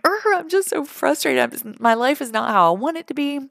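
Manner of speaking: high, exasperated groan of frustration, imitating her past self; frustrated venting. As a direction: whine it out like you're fed up with your life. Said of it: "that tear of frustration".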